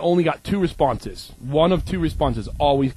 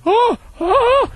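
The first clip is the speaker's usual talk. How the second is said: much higher, louder delighted squeal; squealing toddler; 4 dB louder than usual. Retold or imitated. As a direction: coo like a two-year-old with a kitten. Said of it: "I'm like".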